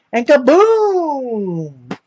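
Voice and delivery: drawn-out, high and loud kaboom; dramatic kaboom. Retold or imitated. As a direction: stretch it slow like an explosion. Sudden dramatic recovery in story.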